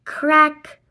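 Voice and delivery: usual speaking voice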